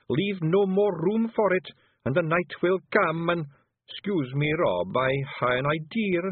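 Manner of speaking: broad Scots brogue, lively and earnest; a wee blue Feagle warning his chief; Scottish pictsie voice. Acted. Feagle character voice.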